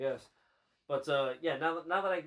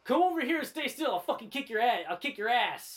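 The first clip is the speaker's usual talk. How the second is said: put-on higher, louder drunken tough-guy threat; belligerent drunk; 7 semitones higher, 4 dB louder than usual. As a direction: pick a fight you can't win. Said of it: "He's like".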